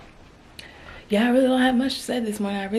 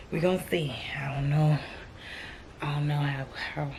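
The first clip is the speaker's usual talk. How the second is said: unsure whispered aside; whispering; 5 semitones lower, 7 dB quieter than usual. Real, not acted. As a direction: whisper it to yourself. Whispered repeat.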